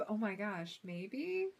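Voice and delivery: normal talking voice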